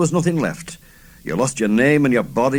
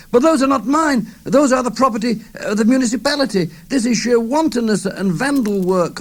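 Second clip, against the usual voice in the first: put-on old man screeching; 13 semitones higher than usual. A narrator's reading. Old man shouting at the boys tearing his books. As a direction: screech in outrage for the library books. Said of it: "began to creech".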